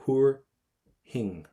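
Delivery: huair thing split in two, loud "hoor", then "hing"; silent letters. Shown as a demonstration. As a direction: model huair thing slowly in two beats, no F or T. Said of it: "we're not gonna pronounce that F".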